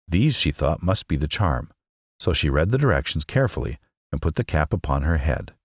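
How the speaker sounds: usual speaking voice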